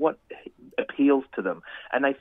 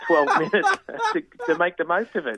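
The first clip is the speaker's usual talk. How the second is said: higher, laughter breaking into the words; laughing while talking; 5 semitones higher, 4 dB louder than usual. Real, not acted. Columnist telling host a tightwad parking story, laughing through it. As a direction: struggle to finish through real laughter.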